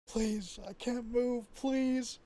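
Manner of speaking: high, soft terrified pleading; frightened plea. Acted. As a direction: beg in paralysed terror. Sleep paralysis victim pleading.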